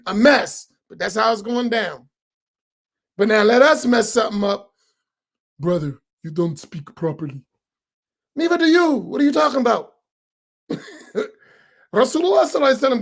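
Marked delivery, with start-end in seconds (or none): 5.6-7.4 low, soft snooty correction; lower, hushed mock complaint; mock critic. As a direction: play the nitpicking brother quietly. Imitated critic scolding.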